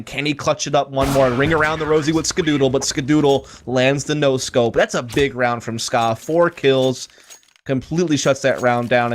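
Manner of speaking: ordinary talk, nothing marked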